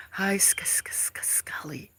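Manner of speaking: stammering whispered greeting; whispering hello to a viewer. Real, not acted. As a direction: whisper the name, stuttering.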